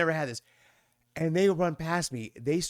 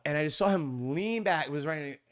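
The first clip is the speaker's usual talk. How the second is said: ordinary delivery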